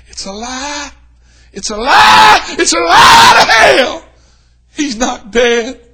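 high, very loud preacher's cry; full-force preaching shout. Acted. Preacher to congregation, shouting defiantly. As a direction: shout the hope over the news.

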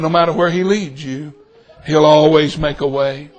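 low, gentle, heartfelt promise; earnest reassurance. Retold or imitated. Customer assures waitress of God's guidance, earnest and gentle. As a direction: drop the pitch and assure her.

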